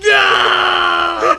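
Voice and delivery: big pretend cry of dismay; mock outraged shout. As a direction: shout no as if personally wounded. Mock dismay at the dog's insult.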